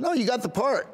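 high-pitched excited reassurance; retold good news. Manager breaking the big news, retold.

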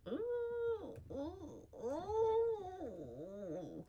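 repeated oohs, the last long and falling; wincing ooh. Real, not acted. Reader reacting to a bad joke, wincing oohs. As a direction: wince aloud in drawn-out, soft oohs.

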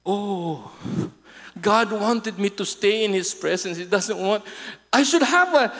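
ordinary talk

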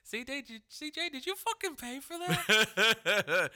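high, slow, squeaky mock disbelief, laughing; incredulous squeak. Talking to C J about the paid video, high squeaky disbelief. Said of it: "I always wanna be like".

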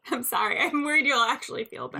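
apologising through laughter; laughing apology. Real, not acted. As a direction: giggle through the sorry. Laughing apology after teasing.

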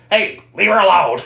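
mock little-kid demand; weird little voice. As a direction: imitate a strange little boy standing up for someone. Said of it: "It's like this weird little voice".

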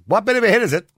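high, loud quiz-host call; hammed-up catchphrase. Radio host teasing callers, loud and high. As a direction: sell the catchphrase to the listeners.

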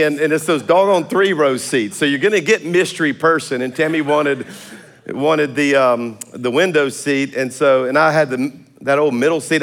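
normal talking voice

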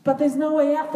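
high, loud despairing cry; frightened cry. Acted. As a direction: cry out trapped with nowhere to go. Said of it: "they cried".

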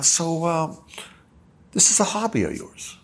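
lightly voiced German filmmaker, high and kind; gentle Herzog voice. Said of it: "in a very nice way".

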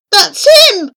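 high, loud cry of triumph; excited shout. A narrator's reading. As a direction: point at the proof, thrilled. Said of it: "I cried".

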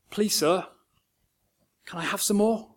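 put-on small timid boy's plea, hushed; tiny little voice. A narrator's reading. Orphan boy timidly asks the master for food, hushed. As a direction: barely dare to ask. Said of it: "Oliver musters this tiny little voice".

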